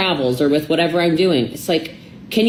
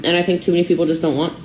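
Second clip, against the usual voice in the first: ordinary talk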